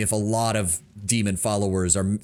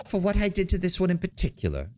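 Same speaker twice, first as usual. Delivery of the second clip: higher, a smug little smirk in the voice; 9 semitones higher than usual. Acted. Cultist mocking the crusaders over the boy, smirking. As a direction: toss it off with a mild sneer. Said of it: "she smirks".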